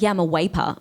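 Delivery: "waper" with a W for V. Retold or imitated. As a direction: say "waper" opening on a W. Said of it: "he says his V's as W's".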